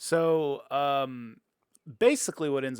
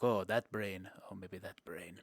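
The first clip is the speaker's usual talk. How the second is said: scheming murmur; 4 semitones lower, 6 dB quieter, slower than usual. Retold or imitated. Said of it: "He's like".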